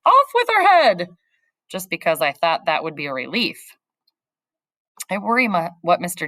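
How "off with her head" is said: shrill royal yell, much higher and louder; hammed-up Queen of Hearts shout. Headache recalled as the yelling Queen, mock yell. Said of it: "always yelling".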